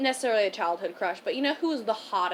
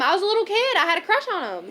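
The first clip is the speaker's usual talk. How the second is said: usual speaking voice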